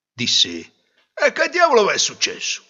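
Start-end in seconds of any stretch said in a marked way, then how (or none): 1.1-2.6 loud, high-pitched startled question; exasperated demand. Acted. Father finding wife and daughter crying, loud exasperation. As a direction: demand an explanation loudly.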